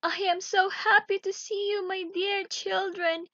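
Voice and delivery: high, loud overjoyed greeting; a mother welcoming her children back, overjoyed; joyful cry. Acted. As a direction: burst with relief at seeing them. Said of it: "she cried".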